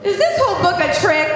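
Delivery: hammed-up panic for the kids; playful laughing shout; mock alarm.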